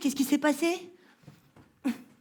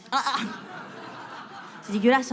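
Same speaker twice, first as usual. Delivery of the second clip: normal talking voice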